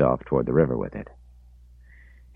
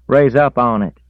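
high, loud country call; loud driver call; 10 semitones higher, 10 dB louder than usual. A narrator's reading. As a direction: call it out loudly. Driver's quote voiced.

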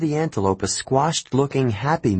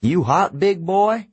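much higher, louder mock sweetness; taunting trucker; 9 semitones higher, 3 dB louder than usual. A narrator's reading. Trucker taunting the caged lion, louder mock sweet. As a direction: tease the lion.

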